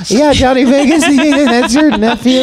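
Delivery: high laughing mock voice, slow at the end; laughing mockery. Mocking the girl over her nephew, laughing high. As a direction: laugh through the punchline.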